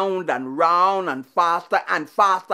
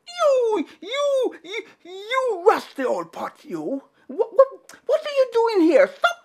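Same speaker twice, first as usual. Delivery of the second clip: much higher, stammering tricky spider voice; high squeaky Anansi; 13 semitones higher than usual. Acted. Anansi sputtering at a rusty pot, high and squeaky.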